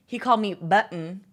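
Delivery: normal talking voice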